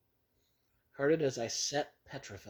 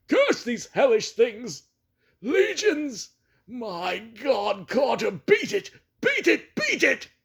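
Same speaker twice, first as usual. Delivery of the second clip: very high, very loud shrieking; horrified shriek; 11 semitones higher, 9 dB louder, slower than usual. Acted. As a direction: shriek out the last warning in terror. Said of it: "rose to a shriek fraught with all the horror".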